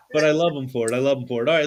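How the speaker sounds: ordinary talk, nothing marked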